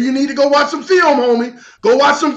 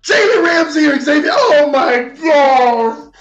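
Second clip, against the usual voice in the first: laughing disbelief. Real, not acted. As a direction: laugh it out, can't believe the comments. Reading absurd comments in disbelief.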